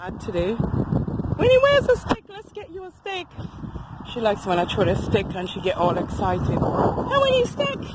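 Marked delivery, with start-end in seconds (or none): 1.4-3.3 very high voice teasing a dog; high-pitched doggy talk; excited pet talk. Real, not acted. Exciting her dog with a stick. 6.5-7.8 very high teasing of a dog; high-pitched doggy talk; excited pet talk. Real, not acted. Showing the dog a stick.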